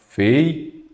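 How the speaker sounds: "Feiura" broken into separate syllables with long gaps; split into syllables. Shown as a demonstration. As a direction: say "Feiura" syllable by syllable, pausing between each. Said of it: "vamos separar em sílabas aqui embaixo".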